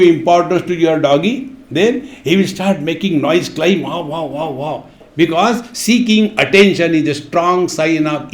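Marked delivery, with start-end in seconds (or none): 3.5-4.9 dog barking imitation. Imitating a dog seeking attention.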